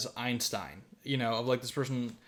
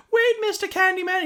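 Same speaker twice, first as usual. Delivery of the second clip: put-on calling child; 4 dB louder than usual. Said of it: "it's like".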